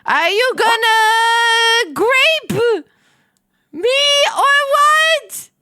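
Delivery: high, slow, stretched-out yell. Acted. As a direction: yell it out slowly. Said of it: "I yelled".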